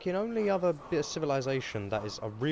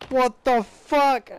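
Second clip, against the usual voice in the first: slow, high, loud wail; stretched disbelieving howl; drawn-out yell; 9 semitones higher, 9 dB louder, much slower than usual. Real, not acted. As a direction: drag the yell out long.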